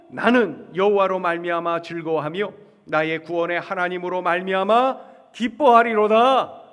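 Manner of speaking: declamatory Bible reading with a loud climax; scripture recitation. Acted. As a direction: lead the verses aloud, swelling to the end. Leads scripture reading aloud.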